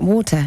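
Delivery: WATER as "WAW-tuh", no R; English pronunciation. Shown as a demonstration. Guest repeats her correction, English pronunciation. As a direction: say water the British way, R dropped. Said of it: "that's the right way to say it".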